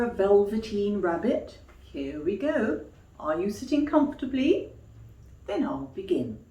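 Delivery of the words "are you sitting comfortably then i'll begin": sing-song, quick "are you sitting comfortably"; cosy read-aloud opener; storyteller opening. Acted. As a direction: invite the children in like a nursery teller.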